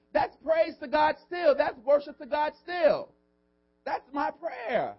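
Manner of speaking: high, slow, forceful declaration; emphatic preaching. Real, not acted. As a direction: preach it out, stretching each phrase. Preacher exhorting the congregation.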